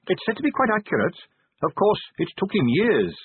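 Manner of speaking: a hint of Carrot laying out the facts; keen subordinate briefing his boss, lightly voiced; earnest young captain. A narrator's reading. Carrot lecturing on battles.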